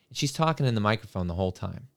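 ordinary talk

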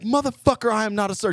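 high, loud mock outrage; indignant high-pitched chewing-out; pissed drill instructor. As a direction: mimic an instructor yelling at a hopeless recruit. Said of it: "she's actually now pissed".

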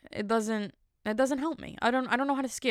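ordinary talk, nothing marked

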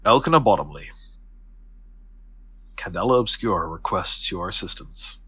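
quiet, weighty formal invitation; formal summons. Acted. Octavia formally recruits the student, grave and measured. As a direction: deliver it solemnly, with a long pause.

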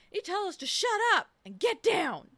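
a hint of Forrest Gump impression; lightly imitated movie character. Quoting Forrest on Lieutenant Dan, lightly imitated.

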